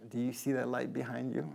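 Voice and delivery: very soft, hushed question. Retold or imitated. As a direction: hush it like a secret. Duchovny quote, creepy aside.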